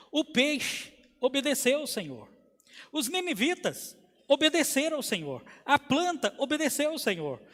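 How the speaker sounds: emphatic preacher's litany, each subject punched; pregação cadenciada. Acted. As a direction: build a list in repeated, forceful cadences. Preaches a rhythmic list. (pregação em ladainha enfática)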